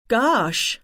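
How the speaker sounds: bright put-on American accent. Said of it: "I said in my best American accent".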